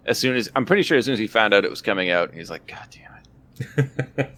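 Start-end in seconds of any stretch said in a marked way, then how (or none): 2.7-4.3 slow, hushed, drawn-out curse under the breath; whispered mock exasperation; muttered exasperation. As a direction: imitate a dad cursing softly to himself. Retold dad's reaction to the news.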